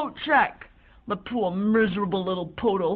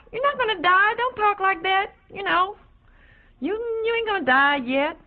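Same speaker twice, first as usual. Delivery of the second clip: reassuring; 9 semitones higher than usual. Acted. Reassuring a dying friend.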